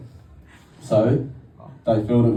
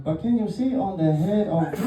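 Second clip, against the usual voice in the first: higher put-on Spanish-accented guide; a hint of a Peruvian guide's accent; mock Peruvian accent; 7 semitones higher than usual. Guide pointing at the statue, in a higher mock Peruvian accent. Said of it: "I'm working on the accent".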